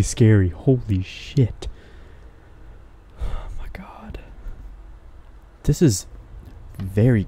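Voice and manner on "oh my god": slow, breath-only whisper; shaken whisper. Real, not acted. Player rattled by a scary game, shaken whisper. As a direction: whisper it in shock.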